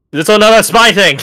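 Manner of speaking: angry high shout. Acted. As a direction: yell it in accusation. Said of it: "She shouts".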